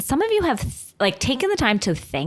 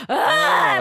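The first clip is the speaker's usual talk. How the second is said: mock shriek. Viewer shrieking at the TV. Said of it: "I was like".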